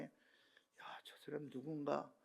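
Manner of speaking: hushed, amazed whisper; a preacher whispering his awe at a vision; awed whisper. Retold or imitated. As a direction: whisper in wonder.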